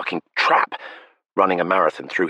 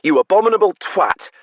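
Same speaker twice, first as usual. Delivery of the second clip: high, loud shout at the trees; 6 dB louder than usual. Acted. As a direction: yell it out to the world. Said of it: "she shouted at the trees".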